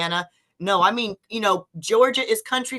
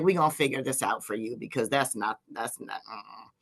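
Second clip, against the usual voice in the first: low quick reassurance; 10 semitones lower, 10 dB quieter than usual. Retold or imitated. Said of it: "She's like".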